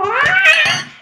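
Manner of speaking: high startled squeal; surprised squeal. Real, not acted. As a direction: yelp in surprise. Surprised by slime.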